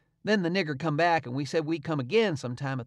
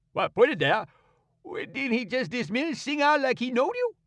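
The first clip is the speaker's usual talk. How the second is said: indignant servant; 10 semitones higher than usual. A narrator's reading. Servant protesting to Tom in a high excited voice. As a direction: insist he heard it plain.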